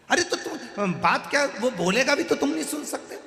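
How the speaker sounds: high-pitched exasperated objection; exasperated protest. Acted. Grandson arguing against the hospital visit, exasperated.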